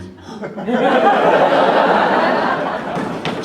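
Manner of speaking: loud high laugh; big laugh. Real, not acted. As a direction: crack up. Laugh after smell joke.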